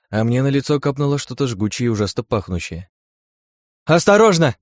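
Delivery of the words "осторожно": loud, high alarmed cry; worried shout. Acted. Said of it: "послышался обеспокоенный голос Ли".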